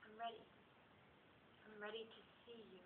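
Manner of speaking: low, very soft, intimate voice; hushed readiness. Acted. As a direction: murmur it low with long pauses. Quotes Bob, solemn and intimate.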